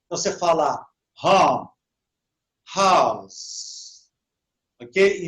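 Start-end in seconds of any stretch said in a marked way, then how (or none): 1.2-4.1 "home" and "house" with a breathed H; aspirated English H. Shown as a demonstration. Teacher showing H as R, falling "home", "house". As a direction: sound the H in "home" and "house" like a Brazilian R. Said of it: "som de R".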